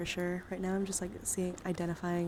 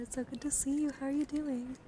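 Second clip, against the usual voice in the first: higher-pitched, sweet hello; friendly greeting; 6 semitones higher than usual. Real, not acted. Streamer greeting a viewer in chat, friendly and high. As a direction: greet a friend who just walked in, pitch lifted.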